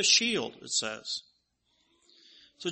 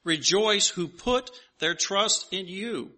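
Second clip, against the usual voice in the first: raised, solemn reading of a psalm; scripture reading; 2 semitones higher, 4 dB louder than usual. Acted. Pastor reading scripture to the congregation, raised and solemn. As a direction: read the verses out to the congregation.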